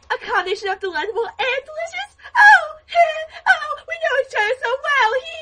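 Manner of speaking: high shrieky cartoon voice; Janet impression. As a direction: squeal it like a gushing cartoon villainess. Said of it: "a very good Janet impression".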